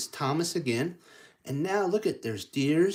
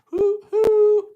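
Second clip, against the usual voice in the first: very high loud hoot; train whistle; 16 dB louder than usual. Acted. Train blowing its whistle, loud high hoot. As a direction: toot like a steam whistle.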